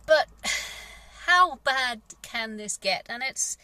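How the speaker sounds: ordinary talk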